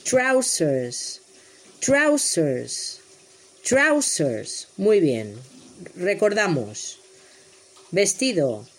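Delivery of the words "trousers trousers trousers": trousers said slowly and clearly; model word. Shown as a demonstration. Teacher models trousers for children, clear and deliberate. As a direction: model trousers for the class to repeat.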